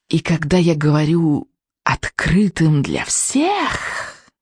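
very slow, drawn-out witch voice; drawling witch. Acted. Witch adding to her announcement, drawling. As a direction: stretch each word out, like a witch. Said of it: "протянула она".

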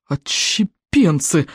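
one word, very slowly drawn out; breathed-out exclamation. Acted. Viking chief spotting outcasts, breathing it out. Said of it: "выдохнул стоик обширный".

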